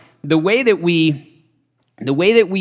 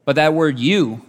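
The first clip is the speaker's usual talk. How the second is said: normal talking voice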